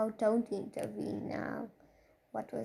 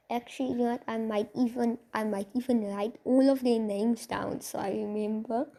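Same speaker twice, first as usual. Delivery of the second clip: ordinary delivery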